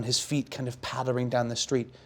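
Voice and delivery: ordinary talk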